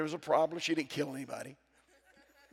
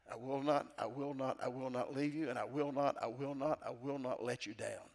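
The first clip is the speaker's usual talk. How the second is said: much softer and lower, almost breathed; hushed promise; 4 semitones lower, 8 dB quieter than usual. Acted. Preacher hushing God's promise, fading to near silence. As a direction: let each vow fall to a hush.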